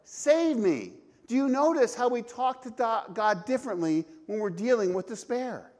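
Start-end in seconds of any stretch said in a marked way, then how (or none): 0.0-0.9 loud, high cry for help; desperate cry. Retold or imitated. Peter sinking in the waves, crying out loud. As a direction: shout it like you are sinking. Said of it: "he says".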